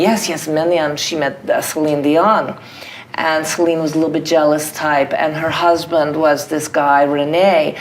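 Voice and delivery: put-on Russian accent, matter-of-fact. Character tells a documentary filmmaker about famous people, Russian accent. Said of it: "And Darlene is saying".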